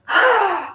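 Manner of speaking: sharp high mock gasp. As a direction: gasp in delighted shock. Reacting to the game twist.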